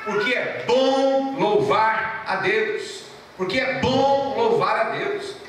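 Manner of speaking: very slow, stressing bom; slow emphatic declaration. Acted. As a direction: drag out each word of Porque é bom louvar a Deus. Slow proclaimed repetition.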